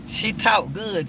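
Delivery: normal talking voice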